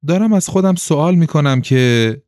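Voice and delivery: high, insistent pressing on; doggedly persistent caller. A narrator's reading. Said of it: "زن با پشتکار تمام گفت".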